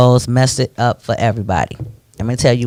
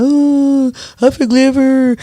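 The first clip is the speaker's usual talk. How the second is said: mock forgiving brother; 9 semitones higher, slower than usual. Mocking the brother forgiving his brother's killer, high voice.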